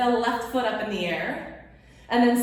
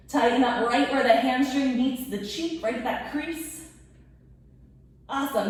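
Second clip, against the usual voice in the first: ordinary delivery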